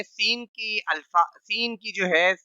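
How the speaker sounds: normal talking voice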